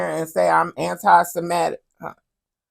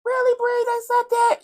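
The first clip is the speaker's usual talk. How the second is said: falsetto surprise. Pretend. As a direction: squeal it in mock shock. Falsetto Bree reply.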